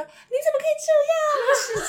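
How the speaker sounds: high, loud, half-crying cry of disbelief; appalled gasp. Retold or imitated. Tutor recalling parents' harsh treatment, appalled high cry. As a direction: wail it like you can't believe your eyes.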